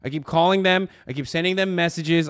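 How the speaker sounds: ordinary talk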